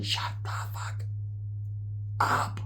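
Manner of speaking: slow, spaced-out whisper; silent signing. Retold or imitated. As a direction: mouth it slowly, almost no voice. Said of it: "trying to sign language".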